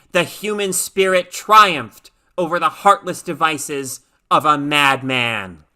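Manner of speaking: loud, ringing oration; commanding speech. A narrator's reading. First staffer addressing the citizens in a loud formal speech. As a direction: proclaim the victory to the nation. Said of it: "her voice sliced through the heavy air like a katana".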